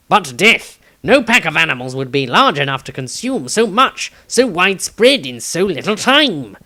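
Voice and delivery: high, loud indignant scholar; a high, loud, flustered man; Tobias voice. Acted. Tobias character voice.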